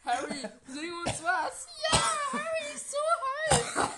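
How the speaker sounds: high shrieking fangirl scream; screaming fan. Pretend.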